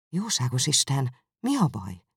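ordinary delivery, nothing marked

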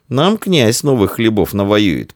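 put-on a touch of boyish bragging, drawn out; boasting. A narrator's reading. Boy to friend about the prince's conquests, boasting. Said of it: "похвастался Амвросий".

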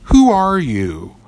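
high, startled who are you; a touch of startled old turtle; sudden question. Acted. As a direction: jump up in pitch, caught off guard. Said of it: "The old turtle suddenly asked".

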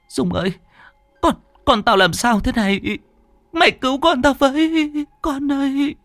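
very high, sobbing plea for help; tearful wailing. Acted. Frightened mother begging her friend to save her baby, tearful wailing. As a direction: wail like a terrified mother. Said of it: "ôm con mếu máo".

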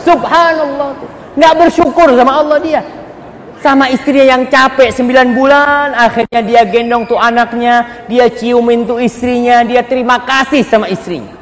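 high, loud preacher's exclamation; preaching at full force. Acted. Preacher marvelling at the changed husband, high and loud. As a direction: proclaim it high over the congregation.

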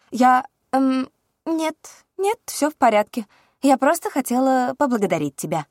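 high, loud flustered princess voice. A narrator's reading. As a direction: cover the slip in a sweet princess voice.

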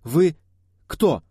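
high, loud, broken-up question; alarmed questioning. A narrator's reading. Irina questions the armed group, alarmed cry. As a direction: demand it in alarm, pausing between words.

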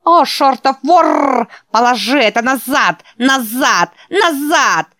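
high, loud buzzing insect screech; housefly. Acted. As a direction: shriek it like a furious giant bug. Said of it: "проскрипел он своим резким жужжащим голосом".

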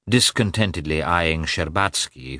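ordinary delivery

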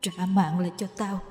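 soft, eerie ghost demanding its life back; hissing ghost. Acted. Ghost haunting Kim, demanding her life back in an eerie voice. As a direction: breathe it out like a hungry ghost. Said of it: "Con ma rít lên".

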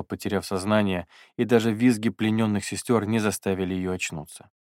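plain reading voice

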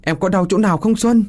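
high, urgent calling; high-pitched cry of concern; worried calling out. A narrator's reading. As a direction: call anxiously to a hurt loved one. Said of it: "Hoài từ phía trước kêu lên".

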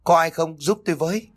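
high, anxious call for help; worried cry for help. A narrator's reading. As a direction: call out anxiously, pitched well up. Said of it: "cô ta lo lắng chạy ra".

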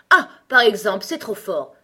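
loud indignant outburst; sudden anger. A narrator's reading. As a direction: explode at the start, outraged. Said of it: "se mettant brusquement en colère". (brusque colère)

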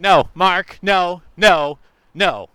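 loud, insistent no; loud refusal. Pretend. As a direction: shout the refusal down. Refusing to turn around.

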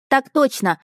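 put-on a small boy reporting eagerly to his captain; boy's voice.

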